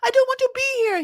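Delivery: sky-high mock French wail; upset French-accented wife. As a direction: whine in protest with an exaggerated French accent. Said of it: "She's, like, so upset".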